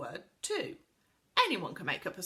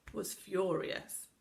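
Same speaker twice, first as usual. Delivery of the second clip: ordinary delivery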